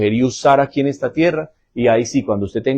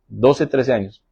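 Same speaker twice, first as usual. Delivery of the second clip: ordinary talk, nothing marked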